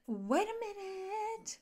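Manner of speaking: high, slow, drawn-out mock disbelief; incredulous. Husband hearing his wife mention a baby room, incredulous. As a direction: stretch the last word up high. Second, drawn-out take of alarm.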